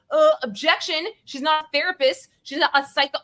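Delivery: pretending to object from the gallery, mock indignant; mock objection. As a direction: play the outraged lawyer. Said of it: "And I wanted to be like".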